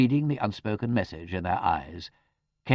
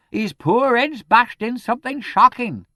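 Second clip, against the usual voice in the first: high, loud, slow charwoman wail; dismally triumphant; 10 dB louder than usual. A narrator's reading. Charwoman announcing the injury, dismal triumph. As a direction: announce the horror with relish. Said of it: "gleaming with a dismal triumph".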